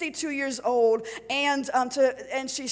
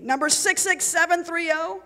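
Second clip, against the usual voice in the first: guard shouting; 6 semitones higher, 5 dB louder than usual. A narrator's reading. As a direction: bark out the number like a guard. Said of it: "shouted to me".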